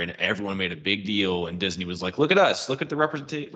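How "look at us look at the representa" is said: high-pitched mock boast; showy, put-on pride, pitched up; self-congratulatory. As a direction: mimic a company congratulating itself. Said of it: "it feels very self-congratulatory".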